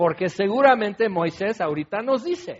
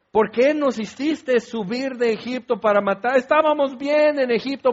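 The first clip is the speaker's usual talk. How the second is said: whiny complaining Israelites; 4 semitones higher than usual. Retold or imitated. The preacher mocks Israelites whining at Moses, high and dramatic. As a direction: overact the grumbling people's protest. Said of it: "Y luego empiezan todo el drama".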